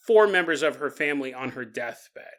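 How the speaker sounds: usual speaking voice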